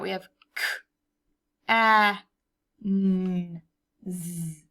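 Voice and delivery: each sound said apart; sounding out words. Shown as a demonstration. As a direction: sound it out letter by letter. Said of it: "let's sound it out".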